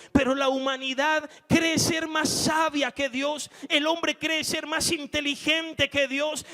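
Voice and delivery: fiery preaching. Acted. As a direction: preach it hard and high to the crowd.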